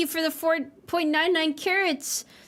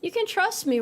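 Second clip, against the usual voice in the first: slow, higher mock soothing voice; mock reassuring; 5 semitones higher than usual. Shown as a demonstration. Telling uneasy chat she won't hurt them, mock soothing. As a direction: reassure them slowly, a little too sweetly. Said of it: "sounds like I'm getting ready to hurt you".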